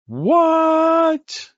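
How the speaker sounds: very high, loud, drawn-out squeal of disbelief; hammed-up shriek of astonishment; mock amazement. Mock amazement at the hypothetical gain.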